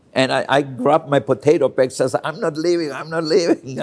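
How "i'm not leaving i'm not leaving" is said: high, insistent mock yell; retold scream. Boy clinging to his potato bag, high mock yell. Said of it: "I just screamed".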